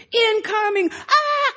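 high-pitched shouted battle cry; mock war-game alarm and scream; little brother's war sounds. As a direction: yell like a kid playing soldiers. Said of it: "he'd do all the sound effects".

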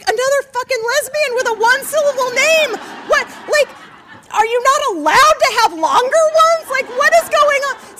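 high, loud, incredulous outburst; exasperated rant. Retold or imitated. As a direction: protest it at a comic shout. Exasperated rant about short names.

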